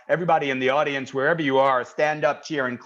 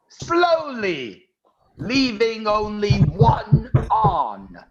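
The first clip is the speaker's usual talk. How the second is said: bad English accent; 6 semitones higher than usual. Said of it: "has had a kinda bad English accent".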